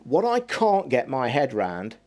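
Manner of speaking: usual speaking voice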